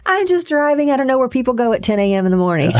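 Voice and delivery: high, bright-eyed disbelief, laughing through it; retelling a giddy, lost call after quitting; high and loud, amazed at her own freedom; excited bewildered. Retold or imitated.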